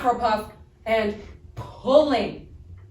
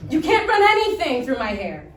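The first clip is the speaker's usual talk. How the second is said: normal talking voice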